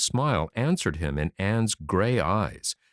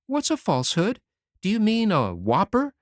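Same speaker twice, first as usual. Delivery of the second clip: put-on high, boyish voice, cheeky and pleased; a small boy proud of his prank; young Davy; 10 semitones higher than usual. A narrator's reading. Davy boasting to Anne about his scare, boyish high voice.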